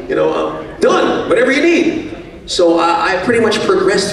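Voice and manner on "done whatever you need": eager yes-man. Retold or imitated. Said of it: "I'm like".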